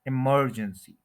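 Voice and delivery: Emergency as i-MER-jen-si, MER stretched; slow emergency with a heavy MER; stress on second syllable. Shown as a demonstration. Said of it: "second के ऊपर stress है".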